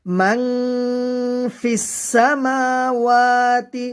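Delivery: "মাং" hummed long, then slow chant; nasal ghunnah. Shown as a demonstration. Teacher showing learners "মাং" with a nasal hum, chanted. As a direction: hold "মাং" in the nose, stretch "সামাওয়াতি". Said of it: "নাকের ভিতরে লুকিয়ে গুন্না করে".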